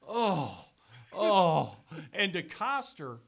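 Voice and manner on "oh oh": loud, rising mock groan of effort; imitated strained groan. Host caught on hot mic, hammed-up loud straining groan. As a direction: ham up the bathroom strain, louder each time. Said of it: "you hear me go".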